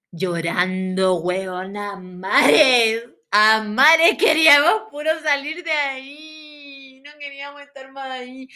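lento lamento lloroso, fuerte al principio; llanto exagerado. Retold or imitated. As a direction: alargar las palabras como llorando a mares. Reenacts crying with the girls. (llanto teatral, arrastrado y quejumbroso)

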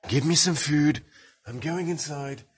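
high-pitched demanding character; put-on character voice. Pompous demanding food, high put-on voice. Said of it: "Pompous, we don't want anything".

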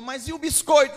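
insistent push. Acted. Believing neighbor pressing the atheist, loud and insistent. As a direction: press him hard on it.

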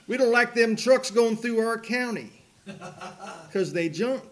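high, loud mock lawman; disapproving local officer. Officer warning trucker off his county, loud mock indignation. Officer's complaint acted out in story.

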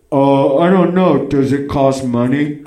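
slow, strained dying voice; slow, labored question from someone fading; sounding near death. Retold or imitated. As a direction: drag it out as if about to die. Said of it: "I sounded like I was gonna die".

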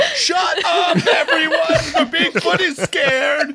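high mock shout for silence; Samantha. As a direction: imitate a woman snapping at a crowd. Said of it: "And she's like".

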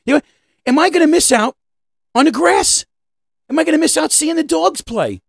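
high-pitched, worked-up self-questioning; mock-anguished high voice; agonized inner debate. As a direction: pitch it way up, fretting aloud. Acting out lunch-break inner agonizing.

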